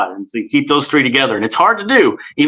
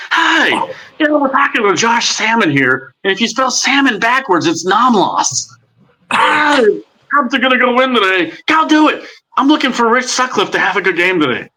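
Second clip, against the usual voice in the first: the befuddled ballpark announcer, pitched up; Harry Caray impression; 10 semitones higher than usual. Shown as a demonstration. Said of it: "my Harry Carey".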